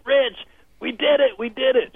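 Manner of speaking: high, bursting with joy at the news; thrilled friend. Retold or imitated. Client calling her coach, thrilled she made it. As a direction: squeal it like you just won. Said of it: "she was so excited".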